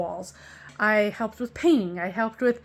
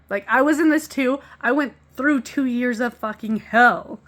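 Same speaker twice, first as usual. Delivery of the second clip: louder, heated and indignant; passionate outburst; 3 semitones higher, 7 dB louder than usual. Real, not acted. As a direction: let real anger at the ex push the voice up. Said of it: "I'm very passionate about this".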